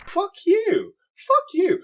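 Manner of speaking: very high, loud playful scream; mock outraged yell. Co-host yelling at host in joking outrage. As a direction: shriek it at your friend in fake outrage.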